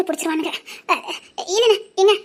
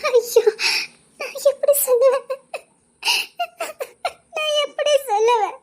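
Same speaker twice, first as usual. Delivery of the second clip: high, tearful wail; crying, unable to confess; sobbing; 5 semitones higher than usual. Acted. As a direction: wail helplessly through sobs. Wife weeping before confessing pregnancy.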